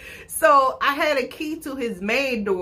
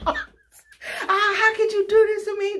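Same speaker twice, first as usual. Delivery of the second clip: hammed-up sobbing plea, much higher pitched; mock crying wail; 12 semitones higher than usual. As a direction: wail it like a mock heartbroken cry. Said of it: "Just crying and just going through it".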